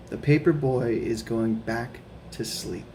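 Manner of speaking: plain reading voice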